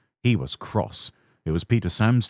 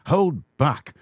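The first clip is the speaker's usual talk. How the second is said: usual speaking voice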